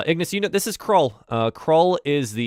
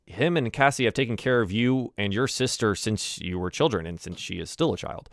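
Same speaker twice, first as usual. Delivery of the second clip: usual speaking voice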